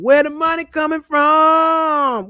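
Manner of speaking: slow, high, loud outraged question; ranting demand. Real, not acted. Ranting about public money.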